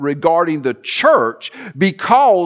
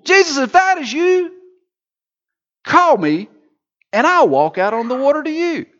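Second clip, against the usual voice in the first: high, eager call across the water; eager Peter; 8 semitones higher than usual. Acted. Peter calling from the boat to Jesus, high and eager. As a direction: call out like a bold fisherman.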